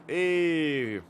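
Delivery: long, high, loud hail; calling out greeting. Real, not acted. Commentator spots someone in the chat, loud greeting. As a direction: greet a newcomer with a big drawn-out call.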